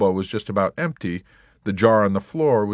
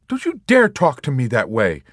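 high, louder indignant retort; 6 semitones higher, 6 dB louder than usual. A narrator's reading. Nurse Kramer scolding Yossarian, indignant. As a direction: bristle at the insult. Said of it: "she replied indignantly".

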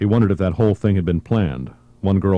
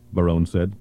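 ordinary delivery

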